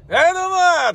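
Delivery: high, loud hearty hello; hearty festival greeting. Retold or imitated. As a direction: call out like a festival regular. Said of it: "とかって".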